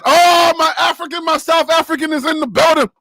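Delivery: high, loud, thrilled welcome; excited greeting. Real, not acted. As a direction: announce him like an arriving star. Greets South African viewer joining.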